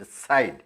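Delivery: DECIDE with the weight on CIDE; teacher modelling stress in DECIDE; word stress demo. Shown as a demonstration. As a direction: stress the second syllable, de-CIDE. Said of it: "यहाँ पर है".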